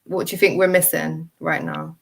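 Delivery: ordinary delivery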